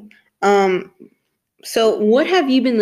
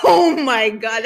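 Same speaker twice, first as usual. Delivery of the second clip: ordinary talk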